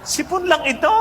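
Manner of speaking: acted-out astonishment, voice shooting up; incredulous. Patient learns from doctor it is a cold, incredulous.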